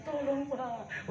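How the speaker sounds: high, soft, drawn-out plea; mock tearful woman. Woman pleading with police over a ticket, mock-tearful. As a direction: plead slowly in a put-on weepy little voice. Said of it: "nangis kalau wanita".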